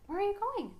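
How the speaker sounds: high-pitched puzzled call; puzzled wife. Acted. Wife as husband gets up and leaves, puzzled. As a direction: pitch it high, acting taken aback. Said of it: "I'm like".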